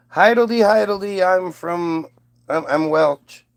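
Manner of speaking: put-on a clumsy try at a Welsh accent; bad Welsh accent. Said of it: "You know a Welsh accent?".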